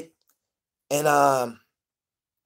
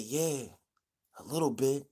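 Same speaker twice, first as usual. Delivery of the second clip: soft, hushed confession; quiet admission; 8 dB quieter than usual. Retold or imitated. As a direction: Bear quietly admitting he is scared. Bear admits fear at night.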